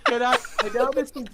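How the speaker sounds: high-pitched talk through real giggles; laughing. Real, not acted. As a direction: try to speak while cracking up. Trying to speak amid group laughter.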